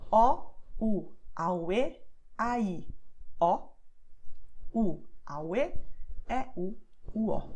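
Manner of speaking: O, u, a, u, e, a, i, o with the o's turned to u; final o as u. Shown as a demonstration. Teacher showing that final o becomes u. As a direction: sound out the vowels, swapping o for u. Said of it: "Repeat with me if you want to".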